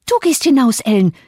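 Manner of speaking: high, loud angry command; trembling-with-rage command; enraged order. A narrator's reading. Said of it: "wiederholte sie am ganzen Körper zitternd".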